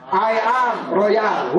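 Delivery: normal talking voice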